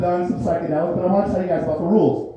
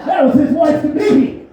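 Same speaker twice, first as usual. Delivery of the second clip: high yelled apology; a comic yelping confession; shouted punchline; 7 semitones higher, 4 dB louder than usual. Acted. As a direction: blurt it out loud and high.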